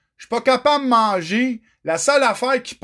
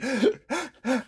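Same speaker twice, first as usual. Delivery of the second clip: sharp panicked intake of breath; short gasping breath of anxiety; anxious gasp; 5 semitones higher, 7 dB quieter than usual. Retold or imitated. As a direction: gasp like a panic attack hitting. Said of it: "pendant que je fais ça".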